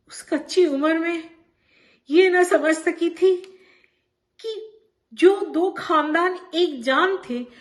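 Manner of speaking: pained rise on each question, as if reliving the hurt; anguished. Acted. Bano writing to Champa about families turned enemies, anguished.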